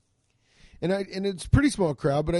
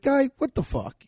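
mock disbelief; 10 semitones higher than usual. Comic reacts to raccoon scratching.

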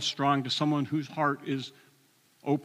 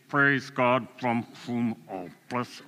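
slow, forced words through gritted teeth; through clenched teeth; 2 semitones lower, slower than usual. Retold or imitated. Angry driver forcing a hymn out in his car, teeth clenched. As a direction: squeeze the hymn out through locked jaw. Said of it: "forcing the words out of clenched teeth".

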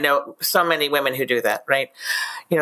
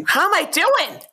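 high, eager question jumping up; excited; 13 semitones higher than usual. Retold or imitated. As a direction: ask it like you can't wait for praise. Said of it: "She was so excited".

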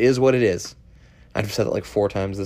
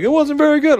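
much higher, louder mock verdict; mocking the audience; 5 dB louder than usual. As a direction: ham up the crowd's disappointment. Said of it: "people were like".

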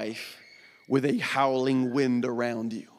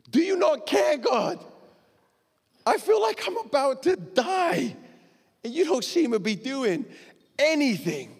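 high, anguished pleading, close to a shout; desperate cry to God; 10 semitones higher than usual. Acted. Believer crying out to a silent God, high anguished plea. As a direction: cry out like someone sure they're drowning.

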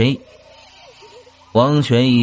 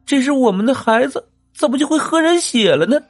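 high, tearful pleading wife; weeping plea; 12 semitones higher than usual. Acted. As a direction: beg the husband through tears. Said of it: "悲泣地看着自己的丈夫".

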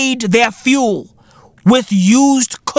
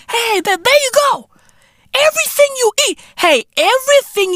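mock excitement; 13 semitones higher, faster than usual. Mocking offer of food.

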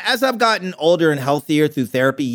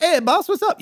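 high-pitched deli guy greeting; imitated deli worker. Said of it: "go into a deli in New York".

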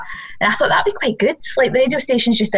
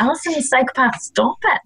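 inner voice scolding; 4 semitones higher, 3 dB quieter than usual. Retold or imitated. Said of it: "that internal voice is going".

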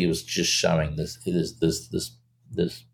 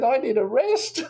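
much higher and slowed, hammed-up weariness; theatrical tired plea, pitched up; mock exhausted. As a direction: play at being wiped out. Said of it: "I said".

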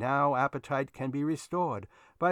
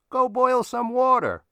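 much louder, higher command; shouting; 12 semitones higher, 11 dB louder than usual. Real, not acted. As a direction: bark it out suddenly, loud. Sudden command after pasta reverie.

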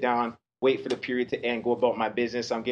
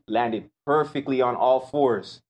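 normal talking voice

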